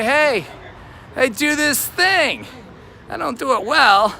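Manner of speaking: mock sales pitch. Matchmaker hanging a shingle up, high mock pitch. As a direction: hawk a bad service in a high, drawn-out voice. Acts out advertising a bad service.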